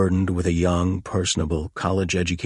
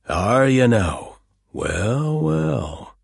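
sly mocking drawl; 6 semitones higher, 5 dB louder, much slower than usual. Sergeant mocking the visitor.

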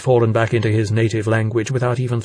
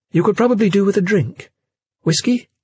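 higher hostess voice, rising offer; character voice; 9 semitones higher than usual. Acted. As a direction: lift the pitch, lilt up on the offer. Jane offers a drink.

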